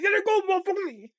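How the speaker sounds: put-on big kid yelling at the grown-up thief; angry yell. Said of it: "And Kenny like".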